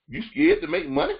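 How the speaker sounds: high disbelieving "You scared to make money?" with a rise; mock the idea in disbelief; incredulous. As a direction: coach scoffing inwardly at the shy client, incredulous. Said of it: "I'm thinking to myself".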